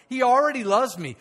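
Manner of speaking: normal talking voice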